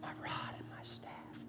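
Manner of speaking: tender, very soft whisper; God whispering. Acted. God reassuring the scared and anxious, in an intimate whisper. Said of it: "God whispers".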